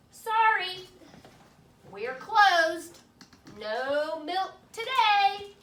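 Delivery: high, loud, slow sign reading; cheeky strike note. Acted. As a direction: announce the strike proudly. Cows' strike note read.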